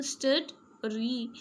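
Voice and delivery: "स्टड, री" as two detached beats; syllables separated. Shown as a demonstration. Teacher showing how the word divides, "स्टड" then "री" apart. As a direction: pause clearly between "स्टड" and "री". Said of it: "ये जब हम pronounce करते हैं".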